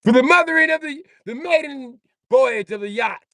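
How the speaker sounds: hammed-up naval ceremony voice; christening the yacht in a mock grand voice; mock ceremonial announcement.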